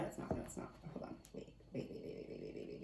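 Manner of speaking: near-silent whisper, checking the cards; whispered to herself. Real, not acted. Reader whispering to herself while rechecking the card order. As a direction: breathe the words while you double-check.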